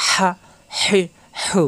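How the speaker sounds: "হা, হে, হু" squeezed from mid-throat; hissing throat h. Shown as a demonstration. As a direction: press the throat and let air rasp on each h. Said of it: "কণ্ঠনালীর মাঝখানে চাপ দিয়ে ফ্যাঁস ফ্যাঁস আওয়াজ".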